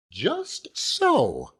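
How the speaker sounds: high, earnest matronly lady voice; high-pitched loyal wife holding forth; Mrs Micawber. Acted. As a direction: lift into the proud, insistent wife.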